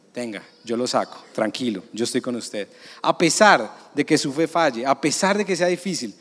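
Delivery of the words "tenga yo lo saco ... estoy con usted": lower, softer reassuring voice of Jesus; calm someone reaching for them; tender reassurance. Acted. Jesus voiced extending his hand.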